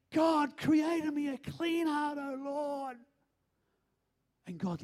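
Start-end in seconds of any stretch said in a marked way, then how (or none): 0.1-3.0 high, drawn-out plea to God; raised, pleading prayer that fades at the end; imitating contrite prayer. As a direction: cry out slowly in acted repentance. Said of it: "that have contrite hearts".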